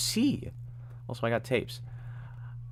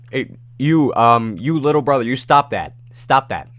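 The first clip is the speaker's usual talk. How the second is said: loud, raised-pitch telling-off; mock scolding; 4 semitones higher, 8 dB louder than usual. Streamer scolding a viewer's little brother, loud mock sternness. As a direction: tell the kid off loudly.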